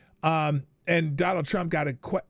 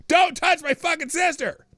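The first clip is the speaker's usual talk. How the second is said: much higher, louder mock scream of the girl; imitated shout; 13 semitones higher, 6 dB louder than usual. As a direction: yell it like a furious teenage girl. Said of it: "repeatedly telling the men not to touch her sister".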